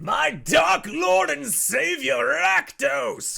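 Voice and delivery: high, loud worshipful shout; grand proclamation. Shown as a demonstration. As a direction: proclaim him like a zealot. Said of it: "With that inflection and that inflection alone".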